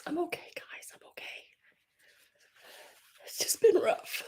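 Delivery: high, broken whisper, fighting back tears; whispering through tears. Real, not acted. Whispering through tears to her viewers that she's okay. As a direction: say it barely voiced, choking up.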